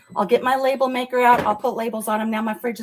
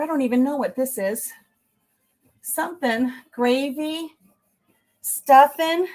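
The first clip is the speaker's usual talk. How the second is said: ordinary talk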